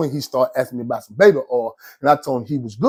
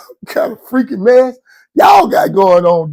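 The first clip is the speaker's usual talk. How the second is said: loud, slow, higher exclaim; mock yell; 7 semitones higher, 6 dB louder, slower than usual. Dude hollering about the mess in the room, slow mock yell. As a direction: bellow it out in disbelief.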